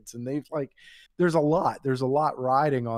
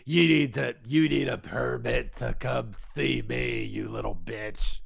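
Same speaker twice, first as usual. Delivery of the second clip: exaggerated nasty old-lady impression; crazy old lady.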